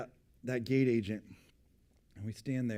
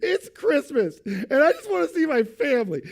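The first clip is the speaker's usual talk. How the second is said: much higher and louder, hammed-up crying plea; tearful outburst; 10 semitones higher, 8 dB louder than usual. Wife pleading tearfully with the gate agent, mock wail. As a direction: wail it like tears are welling up. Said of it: "her tears welled up in her eyes".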